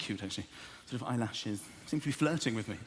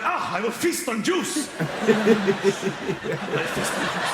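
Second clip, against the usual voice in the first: slow, higher, hammed-up villain vow; mock grandiose; 3 semitones higher, 6 dB louder, slower than usual. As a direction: savour every word like a feasting king. Grand mock vow over juice.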